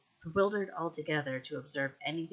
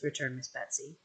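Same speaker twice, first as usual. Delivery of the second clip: ordinary delivery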